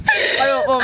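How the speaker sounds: high disgusted cry; disgusted shriek. Real, not acted. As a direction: squeal it in disgust. Tasting a vomit bean.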